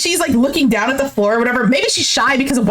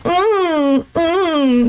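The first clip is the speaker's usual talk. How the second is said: imitated cutesy hums; drawn-out mock-cute mm; cute, mouth full; 3 semitones higher than usual. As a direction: hum coyly as if holding water in the mouth. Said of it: "She's acting all cute while having water in her mouth".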